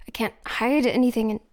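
usual speaking voice

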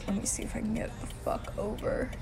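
under the breath; muttering to self. Acted. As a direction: mutter it to yourself. Muttering while merging.

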